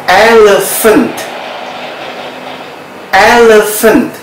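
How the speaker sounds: elephant said EL-e-fant, ph as f; model pronunciation. Shown as a demonstration. Teacher models elephant, ph said as f. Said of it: "pronunciation dalam bahasa Inggris adalah".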